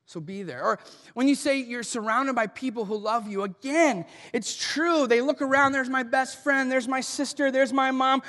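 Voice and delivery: loud, driving pulpit delivery; big-hall preacher voice, pressing every line; preaching at full force. Acted. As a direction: preach it hard to the room. Preacher driving home grief points.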